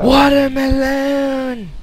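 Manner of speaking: high, loud, drawn-out cry; excited shout. Real, not acted. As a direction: stretch it out slowly, loud and high. Calling out find in game.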